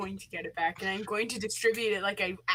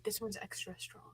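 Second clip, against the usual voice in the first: hushed conspiratorial whisper; sly whispered reassurance; whispered aside; 13 dB quieter than usual. Acted. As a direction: whisper it like a secret. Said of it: "I'm like".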